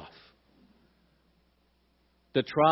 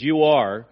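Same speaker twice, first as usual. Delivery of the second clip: louder stress on ARE in "You are"; "You are", with ARE pushed out loudly; emphatic accusation; 10 dB louder than usual. Retold or imitated. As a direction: lean hard on ARE, accusing. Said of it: "is in the emphatic tense".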